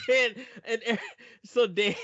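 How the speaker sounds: real laughter breaking through the words; laughing. Real, not acted. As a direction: laugh high while trying to go on. Laughing at Garbodor award.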